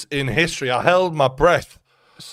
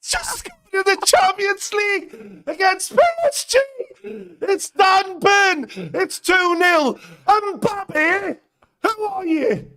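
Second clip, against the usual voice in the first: ecstatic goal scream; slower than usual. Real, not acted. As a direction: scream it out like your team just scored. Celebrating goal against PSG.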